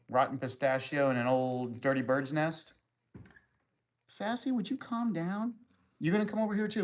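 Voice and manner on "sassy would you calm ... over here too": high-pitched voice for the pet; pet talk. Acted. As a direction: coo at the animal like it's a toddler. Talking to his pet Sassy.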